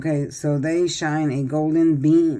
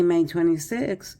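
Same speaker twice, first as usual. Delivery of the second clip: ordinary talk, nothing marked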